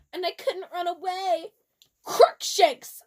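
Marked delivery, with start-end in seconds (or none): 2.0-3.0 high, loud battle cry; hammed-up spell yell; mock shout. A narrator's reading. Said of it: "I shouted at him".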